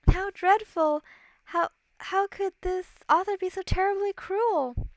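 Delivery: high, dainty storybook heroine; dismayed princess. Acted. Princess aghast at the cruel book she reads, dismayed.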